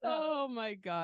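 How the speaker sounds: breathless laughing disbelief. Real, not acted. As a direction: laugh it out slowly. Reacting laughing to the joke.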